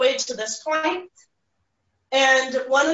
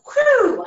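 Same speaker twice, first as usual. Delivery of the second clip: high, loud whoosh; sudden high-pitched "whew" like something flying past; 12 semitones higher, 6 dB louder than usual. Retold or imitated. Teacher imitates grammar going past kids, loud high whoosh. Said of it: "that would go".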